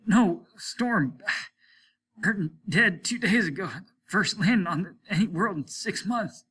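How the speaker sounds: a hint of a fading man gasping it out; mild strained dying voice. A narrator's reading. Dying man's faint reply.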